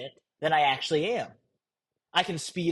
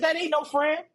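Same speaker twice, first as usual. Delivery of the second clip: blunt retort; 10 semitones higher than usual. Retold or imitated. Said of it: "I said".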